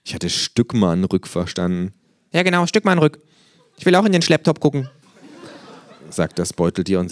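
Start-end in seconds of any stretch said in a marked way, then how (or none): 2.3-4.9 hohe, verspielte Känguru-Stimme. A narrator's reading. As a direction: frech und hoch als Känguru. Känguru spricht. (freche, hohe Känguru-Stimme)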